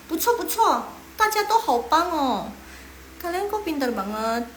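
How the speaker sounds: high-pitched cheering, stretching out at the end; excited praise. Real, not acted. Teacher praising everyone in the class, excited.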